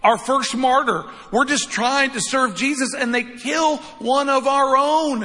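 acted dismay, high and drawn out; imagined freak-out. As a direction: protest like an overwhelmed church, slow and emphatic. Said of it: "They could have freaked out".

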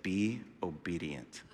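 very soft, low and slow; Holy Spirit's voice. Retold or imitated. Holy Spirit speaking to a new believer, hushed and slow. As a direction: deliver it as a hushed divine prompting. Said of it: "the Holy Spirit said to me".

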